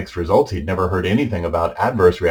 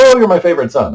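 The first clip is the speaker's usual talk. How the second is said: higher, louder voice for his mother; imitated delighted mother; 9 semitones higher, 6 dB louder, faster than usual. Confused mother greeting her son as if nothing happened, imitated delight.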